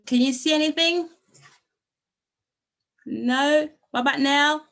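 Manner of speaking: usual speaking voice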